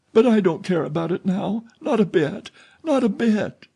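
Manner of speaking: put-on frightened girl pleading against the dark; anguished fear. A narrator's reading. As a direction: beg him in panic, pitched high. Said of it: "Christine's voice of anguish".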